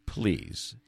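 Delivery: ordinary talk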